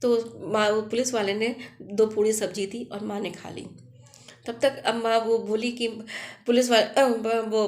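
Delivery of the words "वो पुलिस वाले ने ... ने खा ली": plain reading voice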